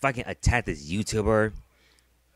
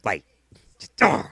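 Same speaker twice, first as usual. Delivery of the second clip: usual speaking voice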